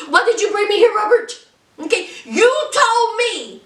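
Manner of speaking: mock tearful plea. Andy confronting Robert.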